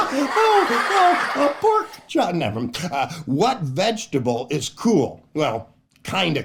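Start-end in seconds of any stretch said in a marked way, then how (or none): 0.0-2.3 high, laughing repeat of the punchline. Real, not acted. Laughing at own joke.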